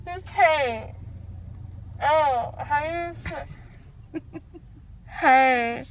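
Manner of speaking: usual speaking voice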